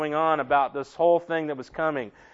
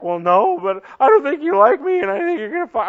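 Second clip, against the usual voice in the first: worried employee; 8 semitones higher, 6 dB louder than usual. Retold or imitated. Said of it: "And he's like".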